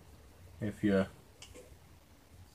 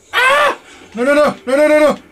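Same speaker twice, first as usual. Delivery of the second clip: shrieking no no no as it grabs you; panicked screaming; 11 dB louder than usual. Real, not acted. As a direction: scream in terror, much higher and louder. Caught by the monster in game.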